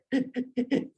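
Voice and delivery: high mock sobbing; put-on high, stuttering sobs; imitated crying. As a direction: imitate someone sobbing down the phone. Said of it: "saya mendengar dia menangis".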